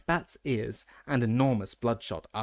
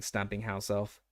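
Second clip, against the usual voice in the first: ordinary delivery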